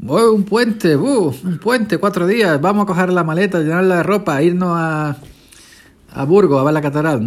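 high, eager mock excitement; excited holiday-goer. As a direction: gush like a holiday planner. Mocking holiday-eager type.

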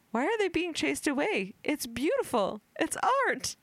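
high, pleading voice; woman's voice. Retold or imitated. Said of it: "a woman is heard saying".

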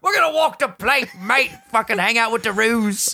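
high, hammed-up Aussie accent; Australian accent gone pirate. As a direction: attempt Australian, slipping toward pirate. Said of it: "I sound like a pirate".

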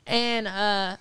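usual speaking voice

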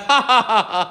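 mock evil laugh; hammed-up villain cackle; devilish laugh. Preacher imitates Satan's evil laughter for the congregation. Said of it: "most devilish of demonic laughter".

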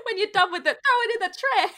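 high-pitched mock Italian American; hammed-up Italian-American, laughing through it; Italian New Yorker. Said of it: "We need an Italian New Yorker".

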